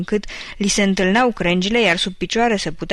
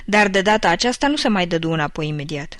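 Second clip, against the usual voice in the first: ordinary delivery, nothing marked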